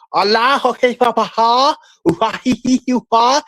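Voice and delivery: high put-on cackle; goofy high laughing run; mock cackle. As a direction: ham up a silly high laugh.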